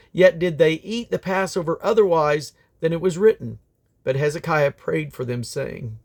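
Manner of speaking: ordinary delivery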